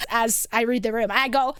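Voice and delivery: normal talking voice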